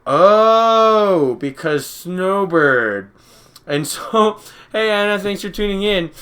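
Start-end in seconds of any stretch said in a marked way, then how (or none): 0.1-3.1 a loud high "oh", then a slow "because Snowbird"; the penny drops, stretch it out; mock-dawning realization; sudden realization. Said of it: "And I'm just like".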